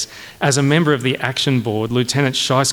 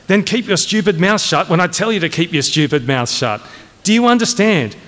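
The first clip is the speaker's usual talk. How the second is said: higher voice, climbing to an exasperated demand; angry order; 5 semitones higher than usual. Acted. Colonel ordering his major to shut up, angrily. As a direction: drum the order into a subordinate.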